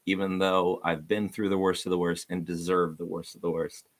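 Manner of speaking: normal talking voice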